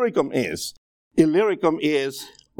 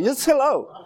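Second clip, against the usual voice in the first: laughing, pitched higher; 10 semitones higher than usual. Real, not acted. Pastor laughing at his own accent story to the congregation. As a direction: chuckle through "Just hello".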